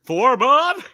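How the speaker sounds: high mock-triumphant battle cry; gloating superhero. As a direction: taunt the enemy with a cartoon transformation call. Mimics gloating superhero call.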